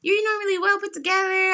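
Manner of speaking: high, gently concerned voice of the receptionist. Retold or imitated. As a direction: receptionist checking on a stressed patient, gently concerned. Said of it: "And she looking like".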